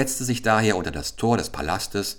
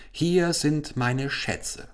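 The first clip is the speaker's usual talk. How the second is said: high, slow royal offer; slow king; 8 semitones higher, much slower than usual. A narrator's reading. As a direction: present the treasure grandly. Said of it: "sprach der König".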